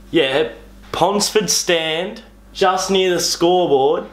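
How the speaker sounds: each landmark spelled out slowly; guiding a friend to your seat, slowly; phone caller at the footy giving his seat, slow and deliberate; slow directions over the phone. Retold or imitated. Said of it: "the guy on the phone".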